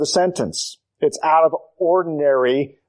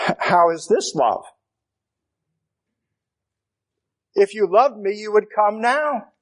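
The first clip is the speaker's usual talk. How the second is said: hurt complaint; 6 semitones higher than usual. Pretend. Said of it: "The temptation is to ask the question".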